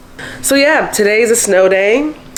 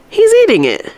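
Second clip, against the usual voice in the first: excited; 12 semitones higher than usual. Real, not acted. Dog finally eats.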